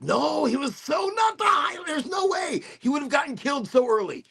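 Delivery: very high, shouted disbelief; incredulous outburst. Retold or imitated. Film fan reacting to a wrong casting idea, incredulous high shout. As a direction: squeal your protest in mock outrage. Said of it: "I saw his face and I was like".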